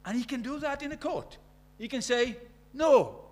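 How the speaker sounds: imitated incredulous outburst, high-pitched, building to a loud NO; horrified disbelief. Man from abroad shocked at what happens in court, horrified disbelief. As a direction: act out a scandalised listener who can't believe it. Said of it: "the man was horrified".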